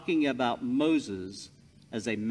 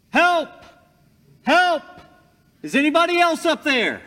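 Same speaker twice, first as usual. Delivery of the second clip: shouted far higher and louder, fast and frantic; frantic yell for help; 11 dB louder than usual. Acted. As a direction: scream for anyone but God. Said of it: "Finally, Jack yelled".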